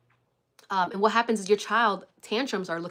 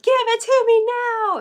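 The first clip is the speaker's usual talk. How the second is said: put-on much higher, louder, slow whiny child wail; dad mock tantrum; 11 dB louder, slower than usual. Dad mimicking his daughter's tantrum in the store, hammed-up whine. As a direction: ham up a toddler's demand. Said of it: "he's like".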